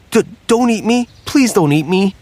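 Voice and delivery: a frightened turkey begging; scared turkey. Acted. Turkey pleads with alligator.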